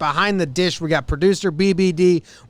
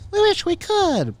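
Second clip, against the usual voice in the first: mock echo; 13 semitones higher, slower than usual. Echoing co-host mockingly.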